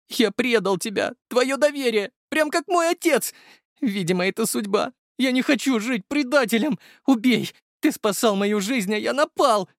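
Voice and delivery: high, pleading confession; desperate young traitor. Acted. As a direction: plead your guilt, voice held high. Dima keeps pleading.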